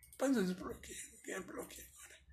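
a hushed private mutter to himself; whispered aside. Real, not acted. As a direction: whisper to himself, fast at the end. Fala consigo mesmo editando. (sussurro rápido para si mesmo)

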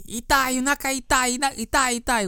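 high-pitched mock cries of pain in Japanese; imitating pained yelling. Patient in the spinning test crying out in high mock pain. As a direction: yelp it high like a patient spun too hard. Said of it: "he kept yelling".

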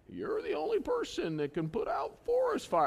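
high, strained cartoon-character voice; botched Smokey Bear. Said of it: "Yogi Bear or Smokey Bear says".

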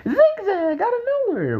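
high, excited commentator call; imitating Jerry Lawler. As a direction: shout the finisher like a ringside announcer. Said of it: "Jerry the King Lawler said".

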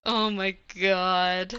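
slow, drawn-out dismay; horrified. Real, not acted. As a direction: groan it out in disgust. Disgusted reaction to gross idea.

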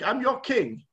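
a hint of a haughty king proclaiming himself; mock royal announcement; pompous king. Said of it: "He's like".

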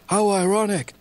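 high, hammed-up commentator line; Michael Cole. Quoting the commentator's catchphrase, high hammed-up announcer. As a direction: call it like a TV wrestling announcer. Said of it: "as Michael Cole said".

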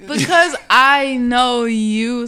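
drawn out, spelling it out; slow, pointed explanation to a friend; slow deliberate. Real, not acted. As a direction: lean on each word slowly.

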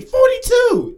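very high, loud shout; shouted high caller voice. Pretend. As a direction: yell it out in a squeaky voice. Caller shouts his age.

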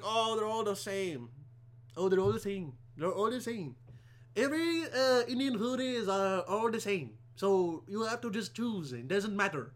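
dragged-out, hammed-up Indian accent; mock Indian accent. Comic mimicking what everybody says about the food, mock Indian accent. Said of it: "Everybody's like".

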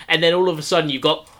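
ordinary talk

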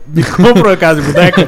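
laughing through the retold command; laughing quote. Retelling the TV report's command to Alexa, breaking into laughter. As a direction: crack up while repeating the request.

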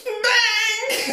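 very high squealed bang; absurdly high, drawn-out silly voice; ridiculous waiter voice. Retold or imitated. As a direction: shriek it as a comical sound effect. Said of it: "he's got the most ridiculous voice".

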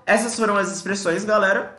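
ordinary talk

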